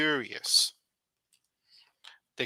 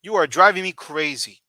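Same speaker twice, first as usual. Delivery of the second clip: louder exasperated "driving me crazy"; 3 semitones higher, 8 dB louder than usual. Shown as a demonstration. Teacher demonstrates the idiom at someone annoying, exasperated. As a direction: tell someone off, punching "driving". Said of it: "It means you're really annoying".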